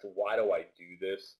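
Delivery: ordinary delivery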